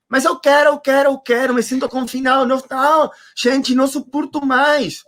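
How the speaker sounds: high, insistent whining complaint; mock tantrum. Speaker mocking middle-class confinement complaints, high tantrum voice. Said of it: "é uma birra".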